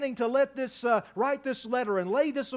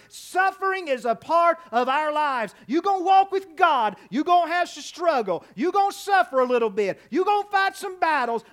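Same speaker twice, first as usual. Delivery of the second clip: raised, louder Southern-pulpit cadence; forceful preaching; 4 semitones higher, 5 dB louder than usual. Real, not acted. Preacher warning church that believers will battle, forceful preaching. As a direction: drive each phrase home to the congregation.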